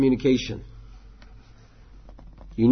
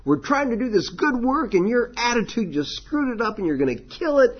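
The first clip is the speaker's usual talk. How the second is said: harsh scolding; 13 semitones higher than usual. Retold or imitated. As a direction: blow up at the volunteer who messed up. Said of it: "It's not like".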